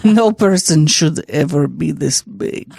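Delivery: put-on slow, low Russian accent; deep, slow mock-Russian verdict. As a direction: imitate an appalled Russian man, low and drawn out. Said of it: "The way you're talking in that voice is like Red".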